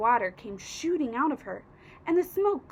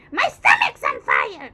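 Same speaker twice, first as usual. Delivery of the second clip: shout; 10 dB louder than usual. A narrator's reading. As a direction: scream for help like an old hag. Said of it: "she shouted suddenly".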